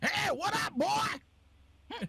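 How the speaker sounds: very high-pitched cartoon old Southerner; old Southern Solid Snake. Player shows how his accent goes wrong, exaggerated old Southern voice. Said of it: "an old Southern Solid Snake".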